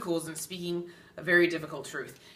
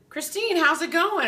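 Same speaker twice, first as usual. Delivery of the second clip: higher, louder chirpy friend greeting; bubbly friend; 10 semitones higher, 5 dB louder than usual. Retold or imitated. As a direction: call out like a cheery friend spotting you. Voicing friend's greeting in story.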